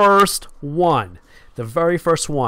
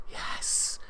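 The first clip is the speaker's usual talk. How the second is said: whispering; 14 dB quieter than usual. Real, not acted. Whispered aside to himself.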